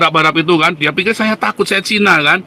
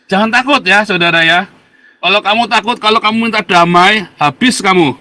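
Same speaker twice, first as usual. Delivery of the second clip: raised, higher urging voice; forceful exhortation; 7 semitones higher, 5 dB louder than usual. Real, not acted. Speaker warning viewers against fear, forceful exhortation. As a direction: warn them loudly and insistently.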